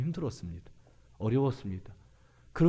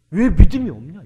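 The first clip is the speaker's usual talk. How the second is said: loud, raised rebuke; Jesus; 6 semitones higher, 17 dB louder than usual. Acted. Jesus challenging the disciples' fear, loud rebuke. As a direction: hit the question hard, much louder and higher. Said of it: "책망하십니다".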